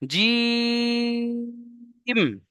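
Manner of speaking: jim held for three alif lengths; the long vowel held like Quran recitation; long drawn jim. Shown as a demonstration. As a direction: stretch জিম out long and steady. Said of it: "থামতে হবে".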